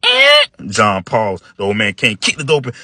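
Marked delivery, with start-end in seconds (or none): 0.0-0.5 high loud creak; squeaky stair. Acted. The stair giving Mike away, loud high squeak. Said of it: "It squeaked".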